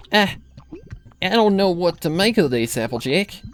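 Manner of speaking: Granny frightened, breathing hard; shaken. Acted. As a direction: grandmother out of her depth, shaken.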